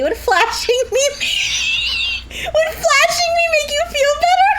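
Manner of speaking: shrieking hammed-up flirt voice; mock seductive. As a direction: squeal the offer like a parody. Absurd mock offer, shouting.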